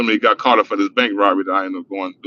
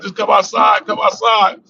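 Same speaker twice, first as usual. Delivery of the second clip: high coaxing call to come outside; police calling out; 10 semitones higher, slower than usual. Retold or imitated. Cop calling into the house to come outside, high coaxing. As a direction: lure them out like a cop at the door.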